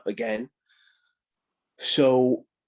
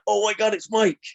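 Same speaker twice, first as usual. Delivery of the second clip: high, raised exclamation of recognition; excited fans; 10 semitones higher, 4 dB louder than usual. Retold or imitated. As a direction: imitate a fan spotting someone they know. Fans spot him in aisle.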